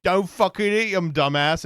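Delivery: loud, high-pitched snap at the poster; loud retort. Acted. Commenter telling off the original poster, loud retort. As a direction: fire it back loud, mock-irate. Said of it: "And this person goes".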